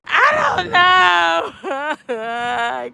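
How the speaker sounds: drawn-out hammed-up sob; tearful daughter answering her mom in a mock wail; mock wailing cry. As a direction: wail the words very slowly, half-crying. Retelling her own sobbing answer.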